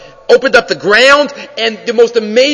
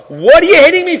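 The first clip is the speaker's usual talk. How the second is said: high, loud, aggrieved donkey; indignant protest; 9 semitones higher, 5 dB louder, faster than usual. Acted. Donkey asking Bilam about the beating, indignant. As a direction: protest the beating in a raised, higher voice.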